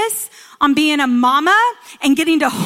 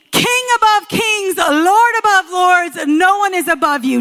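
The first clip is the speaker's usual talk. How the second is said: rising sermon cry; 5 semitones higher, 4 dB louder than usual. Real, not acted. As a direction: proclaim it like a peak of the sermon. Preacher declaring God's majesty.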